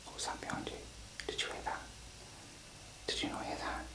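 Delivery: tense hushed whisper. Real, not acted. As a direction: whisper urgently, afraid to be heard. Whispering after noise behind partner.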